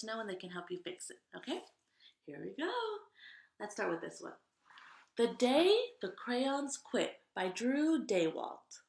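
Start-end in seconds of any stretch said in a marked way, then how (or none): 5.2-8.8 slow, loud announcing of the book title; title read aloud. A narrator's reading. Storyteller announcing the book title to kids, slow and loud. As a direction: present the title to young listeners.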